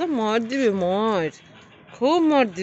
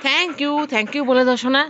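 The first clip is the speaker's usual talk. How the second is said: 1 coaxing pet; 5 semitones higher, 6 dB louder, slower than usual. Real, not acted.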